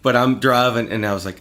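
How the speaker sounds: ordinary talk, nothing marked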